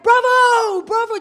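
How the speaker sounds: loud, high cheer from the audience; imitating a full-voiced shout of praise; shouted bravo. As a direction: shout it like an excited heckler in the stalls. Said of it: "someone shouted".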